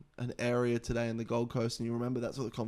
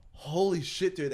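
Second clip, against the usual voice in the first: higher and louder, reliving the shock; startled exclamation; 6 semitones higher, 6 dB louder than usual. Retold or imitated. Retelling the collapse to friends, a startled outburst. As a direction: blurt it out like you just saw him fall.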